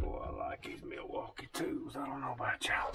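soft breathy whisper to the camera. Real, not acted. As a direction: whisper it like a secret aside. Quiet aside to viewers while working.

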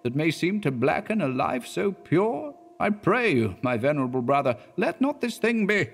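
high pleading minister voice. A narrator's reading. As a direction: beg him as the minister. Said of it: "resumed the Reverend Mister Clark".

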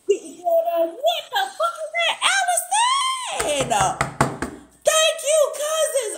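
high, slow, stunned yell of disbelief; real astonished cry, drawn out; shocked shout. Real, not acted. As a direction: shout the name out in amazement. Shocked by a big gift.

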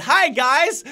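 high, shouted hyped intro; shrill cartoonish hello; Crainer greeting. Shown as a demonstration. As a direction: yell it like a channel opener. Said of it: "I go like this instead".

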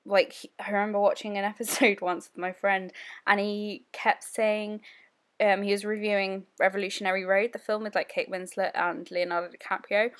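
ordinary delivery

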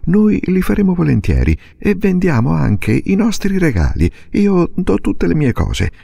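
put-on a girl pleading, voice high and rising; offering everything to her mother; eager young girl Silvia. A narrator's reading. (bambina che supplica con slancio)

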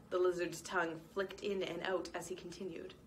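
ordinary delivery

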